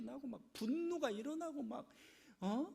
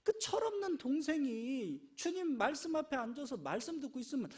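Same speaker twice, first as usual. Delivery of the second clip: ordinary talk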